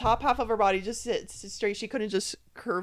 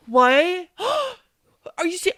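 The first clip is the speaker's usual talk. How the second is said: hammed-up gasping disbelief, loud bursts; mock shock; 2 semitones higher, 8 dB louder than usual. Host imitates Rob playing into her reveal, overacted shock. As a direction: play along with exaggerated astonishment, stretching the last words loudly. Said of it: "Rob is playing into it, being like".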